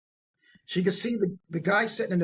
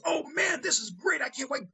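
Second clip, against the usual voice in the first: high gushing; 10 semitones higher than usual. Retold or imitated. Prostitute gushing with excitement.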